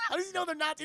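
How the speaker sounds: laughing through the question; laughing repeat. Real, not acted.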